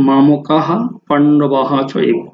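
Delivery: মামকাহা পাণ্ডবাহা চ এব slowly, each হা sounded; measured মামকাহা পাণ্ডবাহা চ এব, চ and এব apart; Sanskrit pronunciation. Shown as a demonstration. Teacher reciting the corrected phrase, মামকাহা পাণ্ডবাহা চ এব slowly. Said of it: "সংস্কৃত নিয়ম অনুযায়ী হ আসবে".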